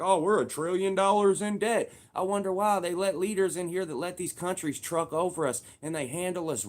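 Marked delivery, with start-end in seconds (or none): 0.0-1.9 louder and higher mock whine; hammed-up fretting; mocking complainers. People mocked over the debt, mock fretting. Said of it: "everybody runs around like".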